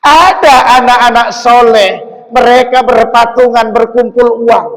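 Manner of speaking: loud, ringing sermon voice; preaching at full force. Acted. Preacher praising pious children to the congregation. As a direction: proclaim it to the congregation.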